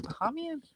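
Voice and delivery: high questioning "Bahamian?"; "Bahamian?" with an ah, rising in doubt; puzzled Bahamian. Retold or imitated. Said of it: "'Cause I'm like".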